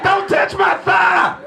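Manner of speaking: high, slow hammed-up shout; hammed-up startled holler; mock yell. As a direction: shout it like you just got goosed. Retells friend jumping up.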